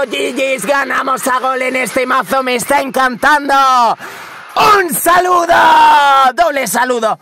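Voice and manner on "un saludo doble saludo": high, loud sign-off yell; celebrating streamer yell; victory shout. Real, not acted. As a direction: shout the greeting like a champion.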